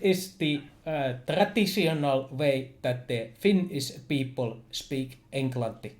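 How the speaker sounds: halting Finnish accent, broken by pauses; Finnish rally English. Shown as a demonstration. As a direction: demonstrate a stop-and-start Finnish accent. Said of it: "That's how you're doing now".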